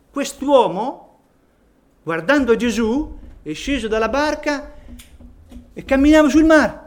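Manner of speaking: rising fervent wonder at the pulpit; excited preaching. Acted. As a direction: build with awe toward the sea. Preacher marvels at Peter's faith.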